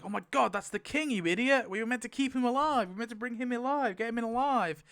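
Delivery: a guard yelling at the shooter over the dead king; panicked soldier. Retold or imitated. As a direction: blurt it out in alarm. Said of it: "the guy's like".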